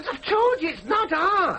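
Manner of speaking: high, drawn-out insistence; exasperated protest. Acted. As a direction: protest in frustration at not being heard. Exasperated son arguing with parents.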